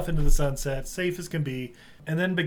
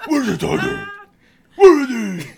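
loud hammed-up Batman rasp-yell; mock Batman growl; 8 semitones higher, 10 dB louder than usual. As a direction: bark it like a movie Batman demanding answers. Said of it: "that was a different Batman".

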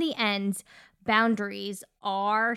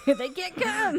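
much higher, playful squeal through laughter; laughing mock protest; 10 semitones higher, 3 dB louder than usual. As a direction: laugh through a put-on objection. Laughing retort to the retold dilemma.

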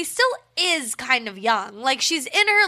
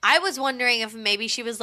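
ordinary talk

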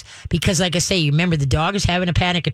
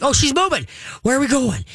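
high eager dog voice, much higher; excited pup voice, pitched way up; voicing the dog; 9 semitones higher than usual. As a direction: voice an anxious dog jumping up. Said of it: "they're up like".

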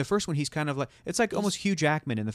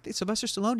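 incredulous; 7 semitones higher than usual. Retold or imitated. Mock incredulous people.

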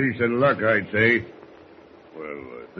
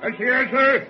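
high bellow to the helm; shouted call; 13 semitones higher, 4 dB louder than usual. Acted. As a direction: call across the deck. Mate calling to helmsman.

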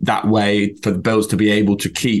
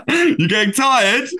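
mocking taunt; 13 semitones higher, slower than usual. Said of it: "looks at him and goes".

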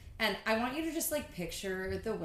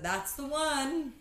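sassy drag queen; 7 semitones higher, much slower than usual. Retold or imitated. Sassy decisive quote.

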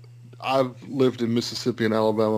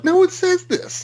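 high, drawn-out incredulous protest; slow it down and pitch it way up in disbelief; exasperated high-pitched objection, retelling own reaction; retold disbelief; 4 dB louder than usual. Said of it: "I was like".

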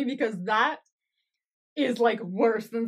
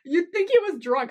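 ordinary talk

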